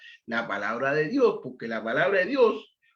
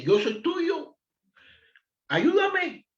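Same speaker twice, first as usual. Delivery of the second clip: pleading cry; 11 semitones higher, much slower than usual. Retold or imitated. Psalmist's plea voiced in preaching.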